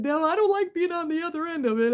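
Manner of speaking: high, laughing voice; imitating her giggly reply; laughing assistant. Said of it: "She said".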